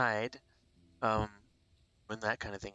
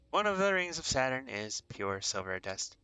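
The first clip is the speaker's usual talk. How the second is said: loud, a little higher fact read at normal pace; fact reread; 3 semitones higher, 5 dB louder than usual. Shown as a demonstration. Vlogger repeating the space fact to viewers, brisker announcer style. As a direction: say the fact again brightly and quicker. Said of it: "rereads the fact".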